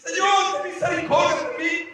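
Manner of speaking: high, pleading cry; retold cry for help; tearful cry. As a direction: beg for mercy, mildly acted. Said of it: "empecé a llorar y a gritar".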